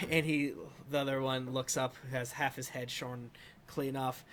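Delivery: usual speaking voice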